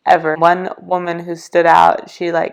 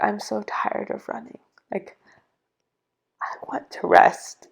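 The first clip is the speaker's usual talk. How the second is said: weary after sobbing; 7 dB quieter than usual. Retold or imitated. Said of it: "I remember just sobbing".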